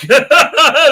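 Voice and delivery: high, loud chuckling burst; loud laugh. Real, not acted. Streamer joking about his own script, laughing loudly. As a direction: burst out laughing mid-sentence.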